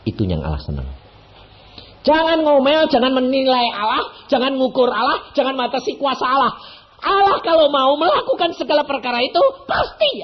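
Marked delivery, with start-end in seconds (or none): 2.1-10.2 high, loud pulpit build-up; soaring sermon peak; preaching at full force. Acted. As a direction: drive the point home to the congregation. Exhorting congregation.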